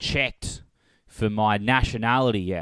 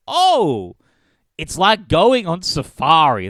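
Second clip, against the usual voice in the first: mock delight; 4 semitones higher, 5 dB louder, slower than usual. Imagined delighted tourist voice.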